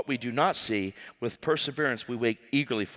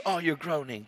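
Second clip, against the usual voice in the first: soft, calm response; gentle realization; 6 dB quieter than usual. Acted. Preacher answering people who hate him, soft and calm. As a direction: drop to a quiet, understanding reply. Soft reply to acted anger.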